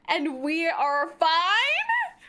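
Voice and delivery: slow build to a loud, high question; incredulous. Real, not acted. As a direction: stretch it out in laughing disbelief. Mock disbelief ending a list.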